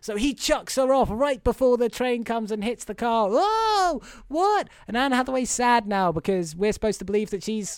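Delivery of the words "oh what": high-pitched exaggerated disbelief; hammed-up astonishment; mock shock. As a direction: let out a high, drawn-out cry of mock surprise. Acts out shock at the crash.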